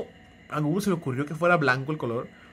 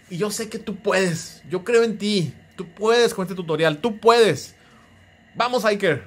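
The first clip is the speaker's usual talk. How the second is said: louder, rallying encouragement; encouraging pep talk; 5 semitones higher, 6 dB louder than usual. Real, not acted. Host cheering on viewer Iker to finish the tutorial, louder and rallying. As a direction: pump him up like a coach.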